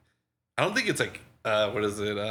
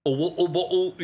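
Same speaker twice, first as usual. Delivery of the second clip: meme voice; 8 semitones higher, 4 dB louder than usual. Retold or imitated. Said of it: "stupid shit like that".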